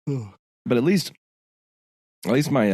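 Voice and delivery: talking through a swollen, sore throat; careful speech with a swollen throat; swollen tonsils voice. Real, not acted. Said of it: "the back of my throat is so swollen".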